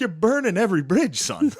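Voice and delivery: high mock-stern dad; hammed-up scolding father; dad impression. Dad scolding son on phone, high mock stern. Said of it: "He's like".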